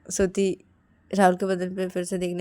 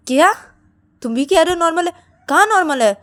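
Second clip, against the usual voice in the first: loud, high-pitched disbelief; indignant challenge; 9 semitones higher, 9 dB louder than usual. Acted. Shruti disputes that the patient is normal, indignant. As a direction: challenge it, voice raised.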